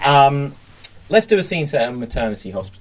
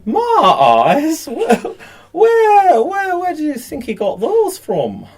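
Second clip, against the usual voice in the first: high nurse voice; 13 semitones higher, 3 dB louder than usual. Acted. Nurse voice in improv scene.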